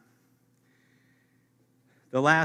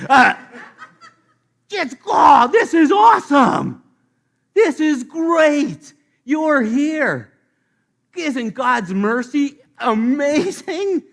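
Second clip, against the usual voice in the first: much higher and louder, slow joyful shouting; overjoyed shout; 11 semitones higher, 14 dB louder, slower than usual. Pretend. Newcomer overjoyed at God's mercy in heaven, shouting. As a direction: shout for joy.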